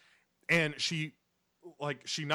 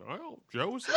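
much higher mock sheriff voice; folksy impression pitched way up; Sheriff Truman; 11 semitones higher than usual. Doing his Sheriff Truman calling to Josie, high-pitched impression. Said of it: "It's my Sheriff Truman from now on".

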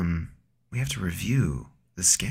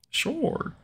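much higher, tight mock sure; squeaky sure. Much higher mock sure in a silly back-and-forth.

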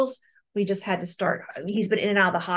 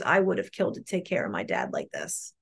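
much lower and softer, tearful father's voice; choked up, quietly voicing the weeping dad; crying father; 10 semitones lower, 5 dB quieter than usual. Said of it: "And he just starts crying".

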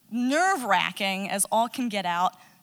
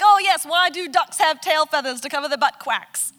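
a touch of character voice; lightly voiced corny joke; mildly acted punchline; hammed-up jester pun; 4 semitones higher, 3 dB louder than usual. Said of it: "It's like".

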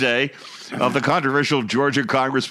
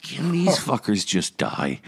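muttered disgust; 4 semitones lower, 4 dB quieter, slower than usual. Real, not acted. Exasperated aside after crowd cheers.

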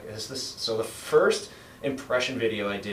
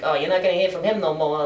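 Joe Pesci impression; 8 semitones higher, 7 dB louder than usual. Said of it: "Joe Pesci saying lines".

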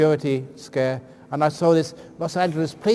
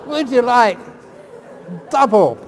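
laughing sales offer; 6 semitones higher, 6 dB louder than usual. Retold or imitated. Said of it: "I used to say".

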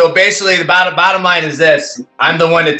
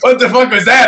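higher, mock-stunned "What the fuck was that?"; shocked disbelief; 8 semitones higher than usual. Bandmate retelling hearing his old vocals, stunned. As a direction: act floored by your own old take.

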